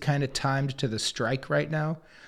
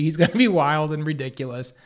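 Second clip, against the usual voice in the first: usual speaking voice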